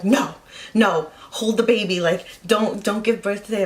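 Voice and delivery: imitating a sister's loud, insistent objection; adamant pleading refusal. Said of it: "so adamant on me not having the baby".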